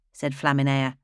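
ordinary delivery

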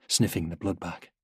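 ordinary delivery

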